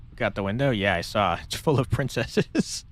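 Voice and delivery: normal talking voice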